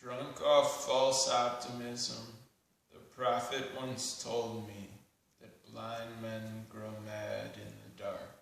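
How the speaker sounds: measured spoken-word poem with long pauses; deliberate poetry reading, lines left to hang; poetry recitation. Acted. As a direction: recite it solemnly, phrase by phrase.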